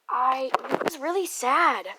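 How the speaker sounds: loud, swooping lament; loud dismayed outburst. Real, not acted. Reader reacting to the sad story, loud dismay. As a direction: exclaim it, falling away at the end.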